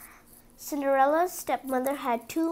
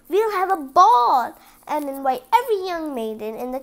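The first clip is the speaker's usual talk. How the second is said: louder royal announcement; excited king; 3 semitones higher, 9 dB louder than usual. Acted. King tells the Grand Duke his plan, louder and eager. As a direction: proclaim the idea like a pleased king. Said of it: "said the king".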